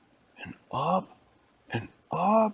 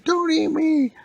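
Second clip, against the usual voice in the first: very high, loud pleading squeal; old lady voice; 9 dB louder, slower than usual. Acted. Old lady begging the monsters not to eat her, high squeal. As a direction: squeak out a plea.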